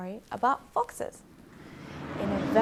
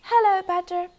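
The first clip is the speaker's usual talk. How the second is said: fox greeting; 11 semitones higher, 10 dB louder than usual. Acted. Foxes greet badger.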